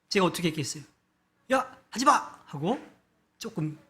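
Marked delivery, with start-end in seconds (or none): 1.5-2.4 retold urgent warning, pitched sharply up; high, mildly raised cry of alarm; urgent shout. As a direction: call out to stop a child, high and hurried. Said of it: "큰 소리로 다급하게 이야기를 했죠".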